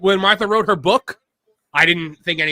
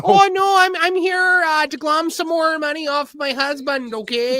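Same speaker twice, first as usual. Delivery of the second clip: put-on Canadian accent; 9 semitones higher than usual. Mocking Martha in Canadian voice.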